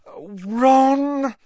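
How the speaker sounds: high, loud exasperated cry of his name; despairing at his nonsense. A narrator's reading. Hermione to Ron, high despairing exasperation. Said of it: "said Hermione despairingly".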